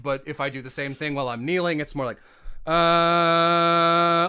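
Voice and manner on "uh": loud, powerful sustained tone; full breath support. Shown as a demonstration. Singer demonstrating breath while kneeling, strong projected tone. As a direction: project the held note with force. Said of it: "there's more power".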